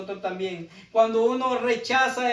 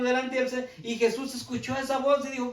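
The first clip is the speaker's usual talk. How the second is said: usual speaking voice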